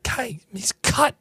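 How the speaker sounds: breathy awed whisper, slow. Acted. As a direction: hush it like a secret crush. Gushing about actor's physique.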